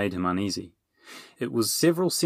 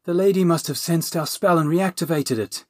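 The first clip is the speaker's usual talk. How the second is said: moaning; 8 semitones higher, 4 dB louder than usual. Acted. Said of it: "the dragon moaned".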